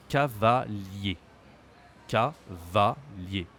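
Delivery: very slow 'Cavalier', split ca-va-lier; drawn-out teaching demo of 'Cavalier'; syllable by syllable. Shown as a demonstration. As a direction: separate the three syllables of 'Cavalier'. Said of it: "Three syllables".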